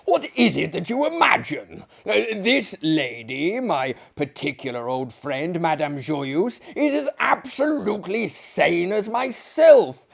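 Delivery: loud, high outraged protest; indignant exclamation. Acted. Maillard defending Madame Joyeuse, loud indignant. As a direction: cry out in French disbelief.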